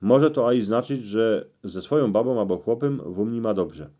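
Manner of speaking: ordinary delivery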